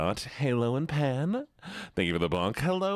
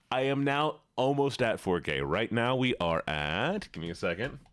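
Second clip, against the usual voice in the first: normal talking voice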